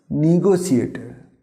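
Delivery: "নেগোসিয়েটার" starting with "ni"; correct pronunciation. Shown as a demonstration. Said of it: "এর সঠিক উচ্চারণ হবে". ("নেগোসিয়েটার" সঠিক উচ্চারণে, নি-গো-সিয়েটা)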